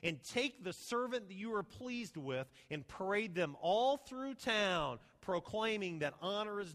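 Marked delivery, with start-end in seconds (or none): 0.0-2.2 pompous Haman. Acted. Haman, imagining his own honour, grandly. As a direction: savour each word like a vain man picturing his own parade. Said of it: "He says".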